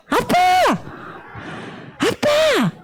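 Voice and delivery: high, stretched demonstration call; child calling dad. Shown as a demonstration. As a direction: call for dad like a little kid. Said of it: "아빠도 한번 불러볼까?".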